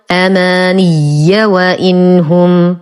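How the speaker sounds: أماني وإنهم very slowly, "amaniyya wa innahum"; connected doubled ya. Shown as a demonstration. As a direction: double the ya of أماني and link onward. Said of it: "وصلا". (أماني وإنهم بتشديد الياء وصلًا)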